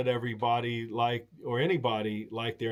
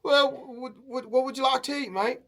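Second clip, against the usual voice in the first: higher, quicker mock Aussie; Australian host; 10 semitones higher than usual. Aussie host asking the hungry guest what to eat, quick high mock Aussie. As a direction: rattle off the question like a chatty Aussie.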